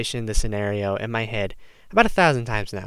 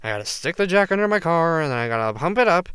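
lightly voiced normal guy; 4 semitones higher, faster than usual. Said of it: "I'm like".

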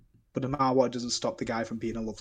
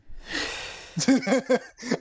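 loud high laugh; 13 semitones higher, 4 dB louder than usual. Real, not acted. Laughs at his own story.

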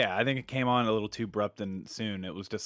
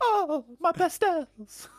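slow, very high cartoonish Italian wail; high, hammy Italian falsetto; mock impression; 4 dB louder, much slower than usual. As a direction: lament in a drawn-out silly Italian accent. Mocking Leto's character in the film.

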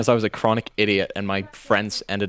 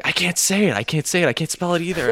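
higher-pitched, lightly acted child quote; lightly teasing kids; 7 semitones higher than usual. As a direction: lightly mimic kids egging a friend on. Acting out friends teasing in kindergarten.

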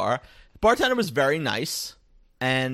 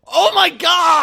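a drawn-out, shocked shout, much higher and louder; 13 dB louder, much slower than usual. Real, not acted. Friend shouting in disbelief at the strip club news, shocked shout. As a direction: scream it in disbelief.

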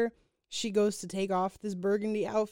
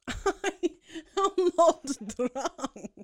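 laughing through a denial; 7 semitones higher, 3 dB louder, much slower than usual. Real, not acted. Co-host laughing off the drunk accusation, laughing. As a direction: giggle out the words.

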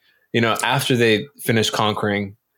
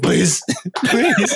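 mock begging; 5 semitones higher than usual. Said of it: "begging for his life".

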